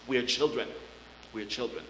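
low, soft and tender; tender hush. Real, not acted. Preacher softly reminding the church they are children of God. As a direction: whisper the truth to them gently.

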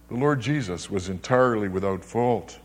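normal talking voice

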